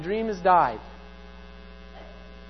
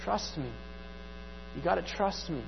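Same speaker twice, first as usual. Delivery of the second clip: hushed, quick, softly voicing God; a light hint of God, calm and soft; gentle God voice; 6 semitones lower, 15 dB quieter than usual. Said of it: "He's just sitting there like".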